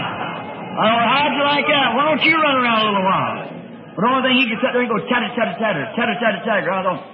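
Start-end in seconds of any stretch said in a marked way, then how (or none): 1.1-3.4 higher, louder, quick taunting voice; playful teasing. Retold or imitated. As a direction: tease the little squirrel, hammed-up. Teasing the chattering squirrel.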